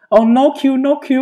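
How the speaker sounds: grandma's cute English. Retold or imitated.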